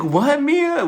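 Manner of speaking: what, are you serious; incredulous what. Retold or imitated. Her own disbelief, retold.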